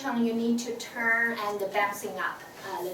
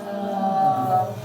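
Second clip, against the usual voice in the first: "Uh" long, low and louder; low third tone; 6 semitones lower, 5 dB louder than usual. Shown as a demonstration. Answering the lunch question, long low "Uh". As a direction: hold a loud, low, hesitating "Uh". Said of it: "what did you have for lunch yesterday?".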